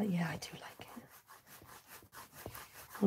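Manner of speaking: soft whisper of approval; whispered aside. Real, not acted. Agreeing with a viewer's favourite colour, whispered. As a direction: murmur it just for yourself.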